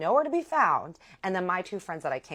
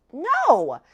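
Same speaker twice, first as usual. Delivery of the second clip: loud, high mock-outraged no; high retold outburst; indignant denial; 10 semitones higher, 10 dB louder than usual. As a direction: act out an emphatic, appalled refusal.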